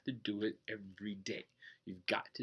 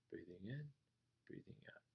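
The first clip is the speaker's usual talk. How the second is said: breath cue trailing off into a whisper; fading to a whisper; 20 dB quieter, faster than usual. Shown as a demonstration. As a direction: let the words dissolve into breath. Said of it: "Oh, yeah, I'm not thinking".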